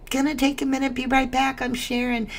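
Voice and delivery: light imitation of her friend announcing a share; imitating a bubbly friend. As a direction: toss it off like a busy mod stepping away. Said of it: "And she's like".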